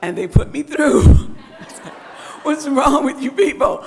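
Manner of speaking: cracking up mid-sentence; laughing. Real, not acted. Laughing at own story.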